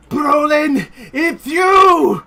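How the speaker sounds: high, loud cry of recognition; overjoyed dwarf crying out; joyful shout. Acted. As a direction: shout it like seeing family again. Relative greets long-lost Brolin.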